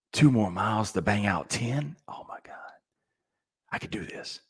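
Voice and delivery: near-silent, breathed inner voice; hushed, acted-out self-encouragement; whispered self-talk. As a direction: whisper it to yourself as a realization on a run. Acts out encouraging self-talk on run.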